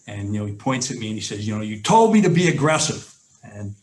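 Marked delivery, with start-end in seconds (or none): 1.5-3.1 excited, higher and louder gloating point; fired up. Retold or imitated. Assistant coach pointing at the head coach, fired-up. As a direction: rub it in happily after the touchdown. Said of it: "he was really excited".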